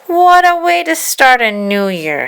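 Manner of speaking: put-on little boy's lament. A narrator's reading. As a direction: drag it out like a disappointed kid. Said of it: "Harry said".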